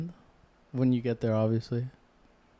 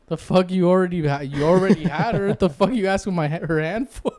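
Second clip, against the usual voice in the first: loud, higher annoyed grandpa; irritated grandpa; 6 semitones higher, 4 dB louder than usual. Retold or imitated. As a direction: scoff at him like an irritated grandpa. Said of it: "my grandpa was like".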